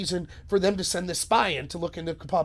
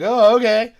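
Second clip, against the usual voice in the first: mock surprise; 3 semitones higher, 13 dB louder, slower than usual. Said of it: "Carter Page is like".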